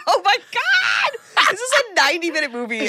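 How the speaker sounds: high, stretched-out cry through laughter, then a rush; mock exasperation. Host reacts to the endless back-and-forth, laughing exasperated cry. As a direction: wail the opening in mock despair.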